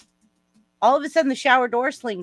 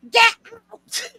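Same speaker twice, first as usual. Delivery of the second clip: very high, loud shriek; retold scream; 7 dB louder than usual. Daughter grabbing towel, yelling at dad to leave, shrieking. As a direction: screech it to chase someone out.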